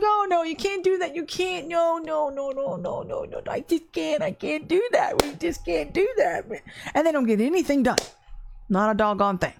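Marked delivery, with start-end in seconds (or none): 0.0-6.5 high, fretful mock protest fading to near nothing; hammed-up anxious naysayer; whiny mercy people. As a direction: play a flustered worrier who refuses everything. Mimicking timid mercy people.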